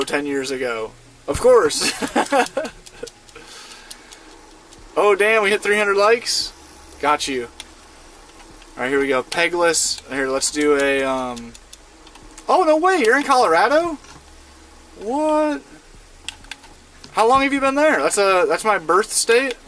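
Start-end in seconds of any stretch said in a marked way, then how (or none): 2.0-2.7 laugh. Real, not acted. Laughs at chat joke. 12.5-18.0 real surprise that a viewer lives in his home state; surprised. Real, not acted.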